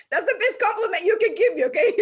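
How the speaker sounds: high, loud, through real laughter; tickled and delighted, voice lifted by laughter; laughing delight. Real, not acted. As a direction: laugh out your thanks for a compliment. Delighted at compliment on accent.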